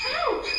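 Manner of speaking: sudden high loud yelp; sharp cry then pained laugh. Acted. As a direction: yelp as if pricked. Pricked by flower.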